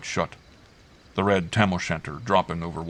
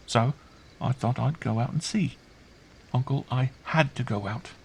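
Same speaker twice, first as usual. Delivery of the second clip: put-on high, hushed child voice; earnest child; 6 semitones higher than usual. A narrator's reading. Girl explaining to uncle why she went, earnest. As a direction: plead earnestly as a small child. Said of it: "she added earnestly, still whispering".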